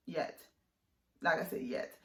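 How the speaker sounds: soft half-whispered aside; quiet aside. Real, not acted. Vlogger adding a playful muted aside to viewers. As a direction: murmur it under your breath.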